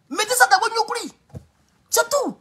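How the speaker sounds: very high-pitched insistent command; high emphatic warning. Real, not acted. Streamer warns viewers off a false friend, high and insistent. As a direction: push the warning up high, at normal volume.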